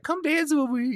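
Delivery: very high singsong grandma invite; grandma inviting. Retold or imitated. Grandmother asking him to dance to country music, high lilt. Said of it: "she'd be like".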